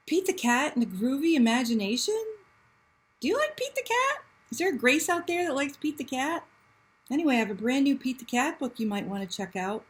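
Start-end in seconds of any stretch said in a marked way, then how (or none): 3.2-5.8 much higher and fast; bright high eager question; excited. Real, not acted.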